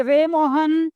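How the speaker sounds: slowed call, a little stretched. Shown as a demonstration. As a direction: call the name, drawn a bit. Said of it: "जैसे".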